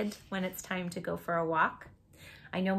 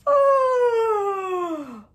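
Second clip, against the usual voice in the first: gasp; 13 semitones higher, 11 dB louder than usual. Acted. Puppy stretches, yawns.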